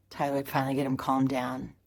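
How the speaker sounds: normal talking voice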